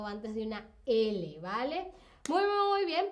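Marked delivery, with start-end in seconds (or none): 2.3-3.1 high, loud "muy, muy, muy bien"; excited praise. Real, not acted. Teacher cheering on chat for a correct answer, excited. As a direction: cheer the students on.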